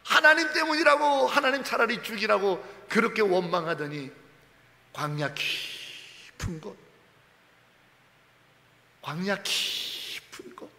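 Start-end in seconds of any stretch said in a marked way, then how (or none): 4.9-10.7 soft, slow near-whisper; hushed evoking. Acted. Preacher softly evoking the deep wilderness, hushed and slow. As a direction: draw them into the deep desert.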